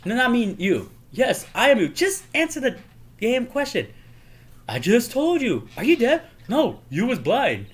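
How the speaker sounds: high-pitched comic argument; mock exasperated bickering. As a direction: bicker both sides in hammed-up irritation. Acted comic who's-on-first quarrel.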